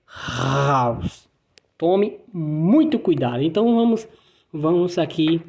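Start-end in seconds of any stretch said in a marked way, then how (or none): 0.0-1.3 "House" low, the throaty R sounded long first; guttural R. Shown as a demonstration. Teacher demonstrating the German R, guttural. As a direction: scrape a long R, then "House". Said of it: "ele é gutural".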